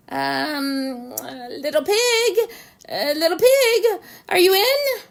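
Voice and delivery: hesitant, rising call at the door; timid calling out. Acted. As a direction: call out politely, unsure. Wolf timidly calls at door.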